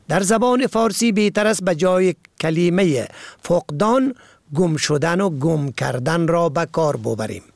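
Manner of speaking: usual speaking voice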